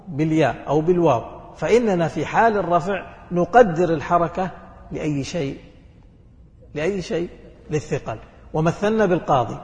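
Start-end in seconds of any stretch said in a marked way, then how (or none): none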